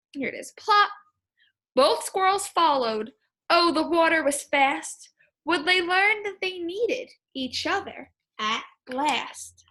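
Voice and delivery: lively rhyming read-aloud; storybook read-aloud. Acted. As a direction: read the poem to the class.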